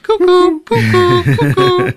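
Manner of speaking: high falsetto cuckoo calls; cuckoo clock. Retold or imitated. Podcast host mimics the clock interrupting a wedding, high cuckoo imitation. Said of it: "And it went".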